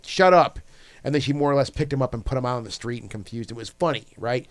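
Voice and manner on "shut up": higher, louder mock snap; retold cutting order. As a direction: cut them off sharply, mimicking her. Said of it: "she's like".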